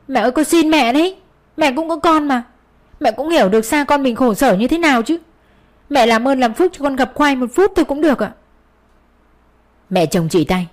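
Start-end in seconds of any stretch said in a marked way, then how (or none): 0.1-8.3 high, pleading voice near tears; a daughter-in-law begging to see her son, high and pleading; desperate begging. A narrator's reading. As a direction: beg her with everything you have. Daughter-in-law begs mother-in-law.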